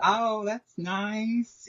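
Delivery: slow, high, sugary fake politeness; polite brush-off. To a stranger recalling talent shows, slow mock-polite. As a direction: draw it out with mock sweetness.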